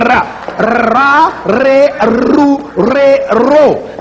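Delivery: ra, re, ru, ro with a tongue-tip trill; trilled R syllables; trilled R demo. Shown as a demonstration. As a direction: roll the R on each syllable. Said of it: "the R sound would be made as an apical alveolar trill".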